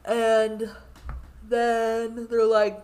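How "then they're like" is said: normal talking voice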